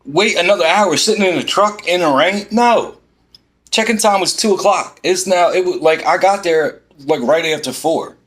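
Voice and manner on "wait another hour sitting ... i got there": can't believe what she's hearing; incredulous outrage. Real, not acted. Told to wait in the rain, outraged and incredulous.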